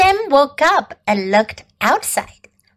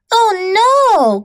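high, drawn-out storybook cry; dismayed cry; 7 semitones higher, 4 dB louder than usual. A narrator's reading. John and Bob after the sled crash, dismayed cry. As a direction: cry out in mild alarm, pitch raised. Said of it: "cried John and Bob".

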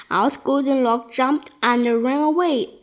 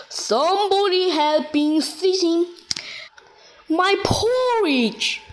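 great huge bear voice; 6 semitones higher than usual. A narrator's reading. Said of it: "in his great huge voice".

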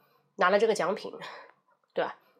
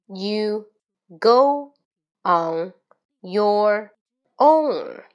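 very slow, each word separate and loud, with pauses between; slow word-by-word reading; 4 dB louder, much slower than usual. Shown as a demonstration. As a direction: sound out every single word slowly and precisely. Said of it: "第一遍纠准每个单词的发音". (一字一顿，慢速大声朗读每个单词)

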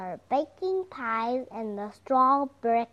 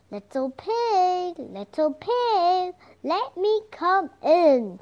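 loud, high wolf calling at the door; 7 semitones higher, 5 dB louder than usual. Acted. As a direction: bang on the door as the big bad wolf. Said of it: "said the wolf".

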